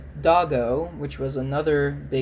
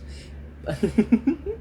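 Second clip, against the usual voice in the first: high giggle; 6 semitones higher than usual. Real, not acted. Laughs at own joke.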